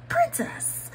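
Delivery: high, sweet storybook "Princess"; cast member's greeting. Retold or imitated. Cast member addressing her as a princess, high and sweet. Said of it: "he was like".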